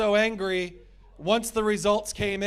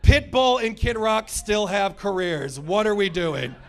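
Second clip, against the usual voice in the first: ordinary delivery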